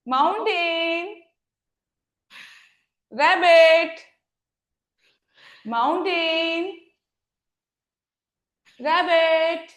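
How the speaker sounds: slow, drawn-out call of each pose name; slow drill. Shown as a demonstration. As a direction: call the poses slowly, one by one. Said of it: "fast करेंगे".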